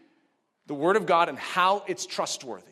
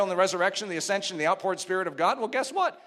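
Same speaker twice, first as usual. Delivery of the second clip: ordinary delivery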